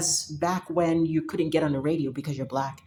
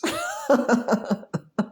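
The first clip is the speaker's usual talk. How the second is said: laugh; 7 semitones higher than usual. Real, not acted. Laugh after punchline.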